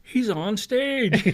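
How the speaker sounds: high, drawn-out imitated holler; crowd exclaiming. Bus tour spotting their friend on stage, high slow holler. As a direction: stretch it out like astonished tourmates. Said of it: "people on the bus tour are all going".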